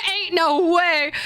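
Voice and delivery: high, slow Southern drawl with laughter; Southern accent. Pretend. Streamer tickled by own accent joke, laughing Southern accent. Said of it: "read the stories in the Southern accent".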